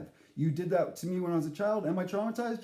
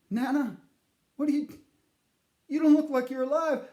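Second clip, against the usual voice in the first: startled grandson; 7 semitones higher, 5 dB louder, faster than usual. Retold or imitated. Startled grandson addressing ghost.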